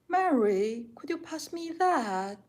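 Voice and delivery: high-pitched British Peppa Pig impression; Peppa Pig British accent. Shown as a demonstration. Streamer asked by a viewer for Peppa Pig, high British accent. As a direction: raise the pitch into a loud cartoon British accent. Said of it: "please do a Peppa Pig accent".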